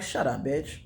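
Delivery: low, quiet, cutting shutdown; cold dismissal. Retold or imitated. Comic recalling shutting the rival down, low and quiet. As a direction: drop it flat and soft to end it. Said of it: "and I was like".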